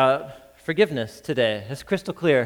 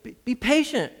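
higher, pleading plea for patience; a debtor pleading for mercy, voice raised high; begging; 8 semitones higher than usual. A narrator's reading. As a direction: beg on your knees. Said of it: "begged him".

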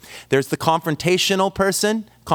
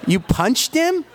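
high, slow disbelieving squeak; stunned, much higher, drawn out; incredulous shock; 8 semitones higher, slower than usual. Retold or imitated. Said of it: "and I'm like".

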